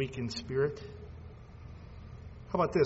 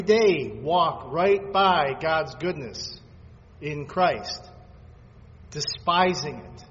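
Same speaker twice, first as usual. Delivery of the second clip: louder, slow, emphatic preacher's voice; preaching at full force; 2 semitones higher, 8 dB louder than usual. Acted. Pastor to congregation on despising goodness, preaching loudly. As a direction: thunder the verse at the congregation.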